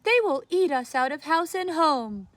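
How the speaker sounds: put-on high, loud fretting old woman; upset old woman. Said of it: "said the very old woman".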